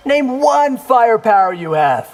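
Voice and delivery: teasing laughed retort; mocking laugh. Mocking ninja's punchline.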